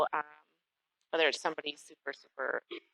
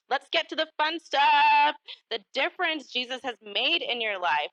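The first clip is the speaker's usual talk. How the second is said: excited lift; 10 semitones higher, 6 dB louder than usual. Real, not acted. Host launching into the fun part.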